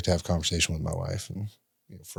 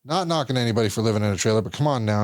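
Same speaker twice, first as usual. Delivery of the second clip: ordinary talk, nothing marked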